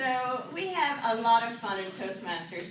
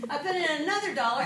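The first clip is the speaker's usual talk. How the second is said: ordinary delivery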